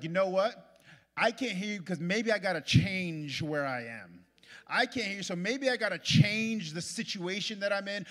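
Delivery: loud, high, driving sermon cry; preaching at full force. Acted. Preacher voicing a believer deciding to change, at full force.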